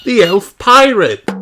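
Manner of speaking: put-on high, loud little elf boy; Ben's voice. Ben warns about the pirate. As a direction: call it out like an excited child.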